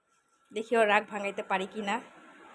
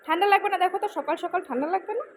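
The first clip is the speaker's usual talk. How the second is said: teasing warning; 7 semitones higher, 4 dB louder than usual. Real, not acted. Mother warning son about cold.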